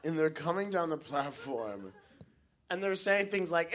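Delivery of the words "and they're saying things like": usual speaking voice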